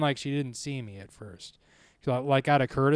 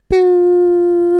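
high, loud sustained ringing tone; ringing in ears; 13 semitones higher, 11 dB louder than usual. Retold or imitated. Man imitates the ringing in his ears, high loud held tone. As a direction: imitate a piercing ear-ring, held long. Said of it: "all I could hear was".

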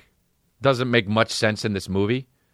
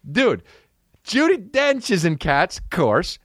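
usual speaking voice

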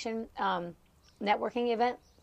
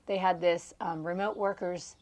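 ordinary talk

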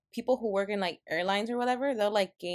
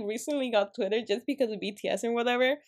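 ordinary talk

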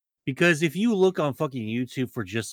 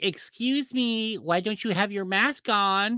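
complaining Karen; 6 semitones higher than usual. Acted. Karen bitching about masks at the grocery store, imitated. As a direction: draw out the complaint in a higher put-on voice. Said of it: "bitch about your masks".

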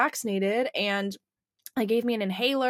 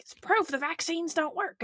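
mocking a troll; 9 semitones higher than usual. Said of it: "jump into my D Ms being like".

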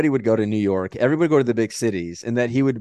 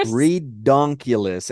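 drawn out; much slower than usual. Real, not acted. Mock word said slowly.

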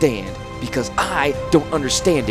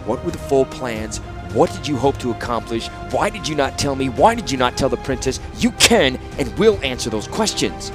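icy. A narrator's reading. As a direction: demand answers coldly. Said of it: "Urza said icily".

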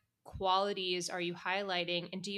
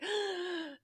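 high, sharp intake of breath; play-acted anxious gasp; mock worried gasp; 11 semitones higher than usual. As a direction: gasp in hammed-up alarm. Acting out anxious letter asker.

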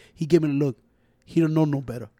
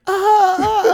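high mock sob, ah ah ah; wailing like a baby to mock him; mock crying wail; 9 dB louder, slower than usual. Mocking her brother as a crybaby, high fake wail.

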